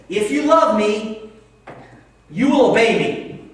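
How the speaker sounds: loud, high, slow stern command; angry God. Acted. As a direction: thunder it like a scolding God. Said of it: "hear that verse like this".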